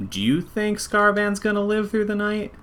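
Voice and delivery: voiced character line, lifted high throughout; in-character question. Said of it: "with our last scene of the night says".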